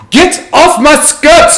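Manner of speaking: high, slow mock shriek of a woman; woman's shriek. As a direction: squeal it out like an offended lady. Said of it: "a woman's voice go".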